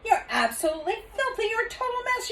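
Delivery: high, loud scolding; scolding father. Acted. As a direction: tell off the noisy girl. Father scolds dirty girl.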